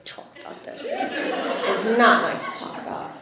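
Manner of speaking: hushed, breath-only scolding; disapproving mother. Retold or imitated. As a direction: imitate a mother's near-whispered reprimand, slowing down. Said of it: "got that eyebrow, you know, the, like".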